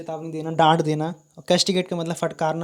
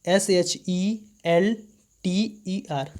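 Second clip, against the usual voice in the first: spelling letter by letter; much slower than usual. Shown as a demonstration. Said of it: "shelter".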